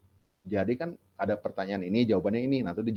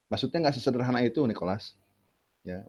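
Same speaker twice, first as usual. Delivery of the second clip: ordinary talk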